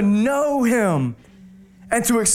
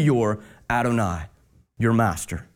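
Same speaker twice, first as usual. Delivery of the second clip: hushed reverent close; 12 semitones lower than usual. Acted. Climax on God's title.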